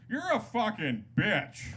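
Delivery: high, slow, drawn-out insult; drunken insult. Acted. Hammered guy insulting the bartender, mock belligerent. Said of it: "the guy just immediately goes".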